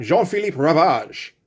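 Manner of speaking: Jean-Philippe Ravage said as a French name; "Jean-Philippe Ravage" in French, long final syllable in Ravage; French pronunciation. Shown as a demonstration. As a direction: say Jean-Philippe Ravage as "zhon fee-LEEP rah-VAHZH". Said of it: "half convinced that he should be French".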